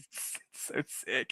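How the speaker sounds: slow, whispery reverence; barely voiced, savoring it; hushed awe. Real, not acted. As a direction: breathe it out softly and slowly. Awed reaction to favorite quote.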